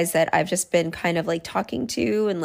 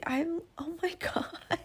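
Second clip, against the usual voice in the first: whispered, breathy with a laugh; embarrassed whisper; 9 semitones higher, 10 dB quieter, slower than usual. Real, not acted. To listeners, admitting something embarrassing, laughing whisper. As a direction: hush it out, mortified and giggling.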